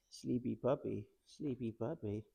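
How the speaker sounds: soft, low cooing to the puppy. Real, not acted. Trainer cooing to the sleepy puppy, soft and low. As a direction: murmur it to a drowsy pup.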